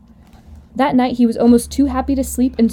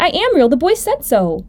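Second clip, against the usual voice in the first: high, louder plea, easing at the end; insist, close to upset; emphatic childlike protest; insistent protest; 6 semitones higher, 3 dB louder than usual. Acted. Little rabbit protests, nearly crying.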